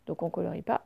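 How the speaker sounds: usual speaking voice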